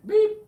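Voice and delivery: short high-pitched loud beep; scanner beep. Retold or imitated. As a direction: imitate a scanner going off. Imitates the chip scanner.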